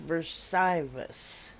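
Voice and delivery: Versivus said slowly as "ver-SIGH-vus"; unsure mispronunciation. Real, not acted. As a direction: drag out Versivus, guessing at the middle vowel. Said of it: "see how it's pronounced".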